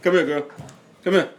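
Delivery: high, loud coaxing call; calling granddaughter. Real, not acted.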